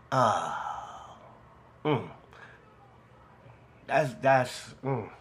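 halting, low, wordless pauses while fighting emotion; choked up, emotional. Real, not acted. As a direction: stall on low murmurs, too moved to go on. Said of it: "I'm quiet right now 'cause I'm getting emotional".

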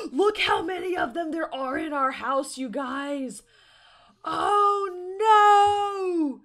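gasp, then loud high exclamation; aghast and shouting; shocked outcry. Real, not acted. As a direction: cry out, building to a long wail. Sees house full of gnomes.

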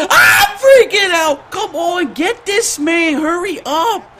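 high, wailing panicked shout; freaking out. Real, not acted. Gamer urging the team to hurry, freaking out.

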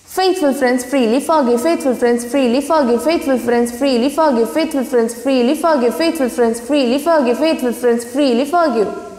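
quick repeated run; brisk tongue twister. Shown as a demonstration. Teacher drilling the twister for students, brisk. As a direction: rattle it off again and again. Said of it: "let me show you".

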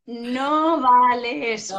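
loud, slow, drawn-out ruling; emphatic objection. Real, not acted. Psychologist chiding parents using an excuse, loud playful objection. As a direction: call it out loudly, stretching the words.

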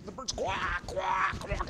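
loud strangled quack, quack, quack; choked parrot quacks. Acted. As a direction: squawk like a bird being shaken. Parrot shaken, squawking.

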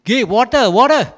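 loud, high call for water. Retold or imitated. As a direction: shout across the room for water. Calling the musicians for water.

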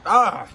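sharp cry of burning pain; real pained ah; pained gasp. Real, not acted. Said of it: "I keep touching my fucking face".

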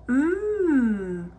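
long, contented "mmm"; savouring hum. A narrator's reading. Aluki drinking the littlest bowl, savouring it. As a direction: hum slowly as if tasting something delicious.

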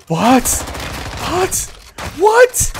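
rising, disbelieving "what"; stunned, high disbelief; shocked. Real, not acted. Shock at game event.

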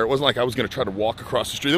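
normal talking voice

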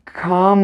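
"Kam" drawn out with a held m; syllable read-out. Shown as a demonstration. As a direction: sound out "Kam" as one long syllable. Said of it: "İlk hecemiz nasıl okunur?". ("Kam" hecesini uzatarak okuma)

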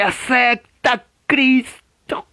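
high, slow, drawn-out call; stretched, impassioned altar call; pleading revival preacher. Acted. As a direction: imitate a preacher wailing the invitation over a choir. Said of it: "el predicador diciéndote".

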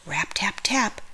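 whispered rap, tap; breathy hushed knocking sounds; soft tapping. A narrator's reading. Narrator whispering the knock for the children.

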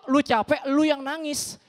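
usual speaking voice

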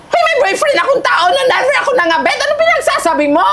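high-pitched, outraged protest; indignant denial. Acted. Denies being the other woman. (pasigaw at galit na pagtanggi)

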